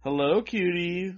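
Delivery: high, drawn-out teasing calls; mock catcalling yell. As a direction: taunt in a sing-song falsely sweet call. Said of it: "the boys are yelling out".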